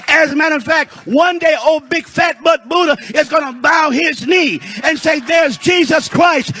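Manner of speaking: high, forceful pulpit proclamation; impassioned preaching. Acted. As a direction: preach it hard, driving each phrase home.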